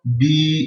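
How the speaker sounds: "Bi" with the vowel stretched out; prolonged i. Shown as a demonstration. Teacher repeating the final long i, "Bi" drawn out. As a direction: linger on the i of "Bi". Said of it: "il faut prolonger le i".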